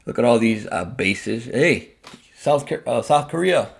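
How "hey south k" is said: ordinary talk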